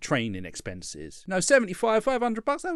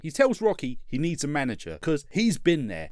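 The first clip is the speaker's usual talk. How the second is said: ordinary talk, nothing marked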